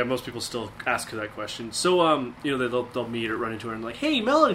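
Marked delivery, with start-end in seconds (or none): 4.0-4.5 much higher, louder bright hello; high, loud sing-song hello; mock cheery greeting. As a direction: greet her like a gushing acquaintance. Imitating people greeting her.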